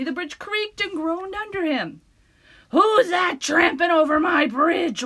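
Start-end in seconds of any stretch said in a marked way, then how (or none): 2.7-5.0 loud, slow, higher troll roar. Acted. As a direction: bellow it slowly. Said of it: "roared the troll".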